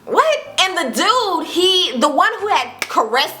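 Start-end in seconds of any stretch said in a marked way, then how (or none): 0.0-0.4 high surprised what. Retold or imitated. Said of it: "And I'm just like".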